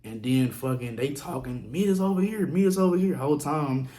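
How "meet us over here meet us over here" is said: higher, louder mocking call; mock taunt. People in the car calling him over, higher mocking taunt. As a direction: throw their taunt back at them, ridiculing.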